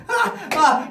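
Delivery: loud, high put-on laugh; mock group laughter. Courtiers laughing along with Pharaoh, jeering laugh. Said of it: "And we were laughing too".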